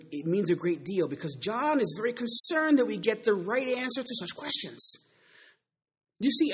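from emphatic reading up to high, shouted climax; mounting pulpit fervour; building preaching. Acted.

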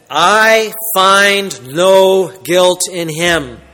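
slow, weighty "I find no guilt in him"; emphatic. Retold or imitated. Preacher stressing Pilate's verdict, slow and emphatic. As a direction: lean on "I", drawn out slowly. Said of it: "Notice he states again".

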